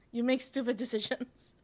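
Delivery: put-on Israeli accent; shitty Israeli accent. Officials telling her off, imitated Israeli accent. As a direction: criticize in a mock Israeli accent. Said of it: "shitty Israeli accent".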